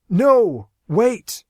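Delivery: very loud, high cry; anguished shout. Acted. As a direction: cry out as it closes. Aaron cries as hatch shuts.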